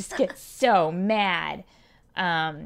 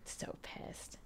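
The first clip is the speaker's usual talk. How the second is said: whispered; 19 dB quieter than usual. Real, not acted. Laughing aside after mom quote.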